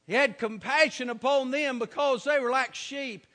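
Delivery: normal talking voice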